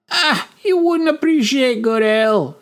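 put-on sharp-tongued barmaid scolding a drunk. A narrator's reading. Barmaid Rella retorts.

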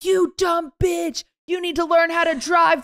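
high mock yell of a furious driver; imitated yelling. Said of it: "he's just yelling".

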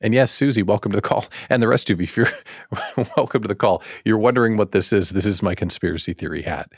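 ordinary talk, nothing marked

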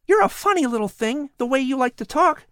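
put-on high, eager boy voice; a curious boy talking to a cat; young boy Patrick. Boy Patrick wondering aloud to the cat, high and eager.

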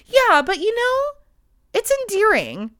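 lightly voiced friend brushing it off; imitating cheerful friends. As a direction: chirp it like a doting friend. Voicing friends reassuring her.

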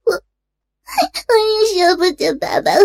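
high, drawn-out wailing sobs; sobbing confession. A narrator's reading. Daughter explains to parents, terrified of divorce, sobbing. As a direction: choke and stammer the words out through sobbing. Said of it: "听了小玲的哭诉".

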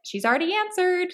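put-on high-pitched kid correction; lightly voiced know-it-all kid; imitated sibling.

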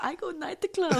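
high, slow Korean-accented mom imitation. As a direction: tease in a Korean mother's accented English. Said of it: "in Korean, like".

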